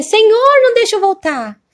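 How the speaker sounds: high retold outcry to the Lord; pleading cry. Woman waking in her bed begs God not to send her back, pleading cry. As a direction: call out high on the first word, an imploring plea that falls away.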